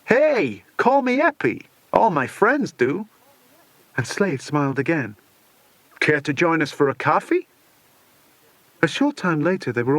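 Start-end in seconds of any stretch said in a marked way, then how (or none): 0.1-7.5 high, loud, over-friendly pitch; smooth charmer. Acted. Slade to Alison, loud hearty fake friendliness.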